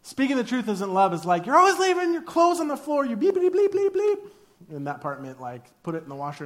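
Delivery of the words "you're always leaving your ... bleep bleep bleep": high, loud nagging partner voice; hammed-up high nag about clothes on the floor; mock nagging spouse. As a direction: whine it like an exasperated spouse.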